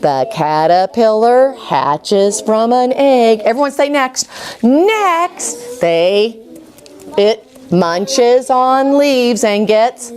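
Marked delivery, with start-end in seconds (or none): 0.0-3.4 slow, clear read-along pace; teacher leading the class, reading slowly together; slow choral reading. Shown as a demonstration. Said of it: "everybody's saying it with me". 4.6-10.1 slow, clear read-along pace; teacher leading the class, reading slowly together; slow choral reading. Shown as a demonstration. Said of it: "everybody's saying it with me".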